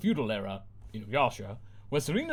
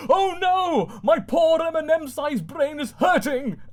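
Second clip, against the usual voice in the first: high loud panicked wail; screaming; 7 dB louder, much slower than usual. Acted. Girl whose brain hurts, screaming. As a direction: shriek in pain and panic. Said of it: "then screams".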